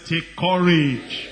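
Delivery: slow, loud, ringing take courage; emphatic shout. Shown as a demonstration. Preacher rousing the congregation, slow loud shout. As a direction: call it out slowly and loud. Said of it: "I said".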